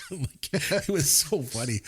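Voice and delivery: laughs through the words; breaking into laughter as she says it; laughing. Real, not acted. Recalling the charades scene to her cohost, laughing.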